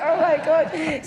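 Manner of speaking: high, loud laughing "oh my God"; mock-appalled outburst through laughter; retold dismay. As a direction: cry out in comic horror, drawn out. Retold reaction to financier's retort.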